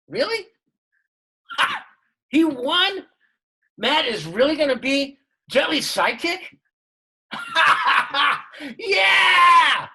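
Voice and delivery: thrilled disbelief bursting into a cheer; excited dad. Acted. Dad on the phone hearing his son won, joyful shouting.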